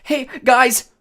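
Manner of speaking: high, loud call from above; excited high-pitched shout; yell. A narrator's reading. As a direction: holler down to friends. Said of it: "Grover yelled somewhere above us".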